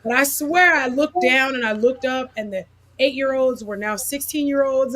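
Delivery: ordinary talk, nothing marked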